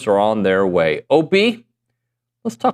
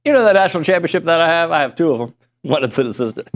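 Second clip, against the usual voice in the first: imitating Jimbo; 5 semitones higher, faster than usual. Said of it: "He had to throw that".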